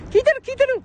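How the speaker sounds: squeaky imagined listener voice; high excited reply. Pretend.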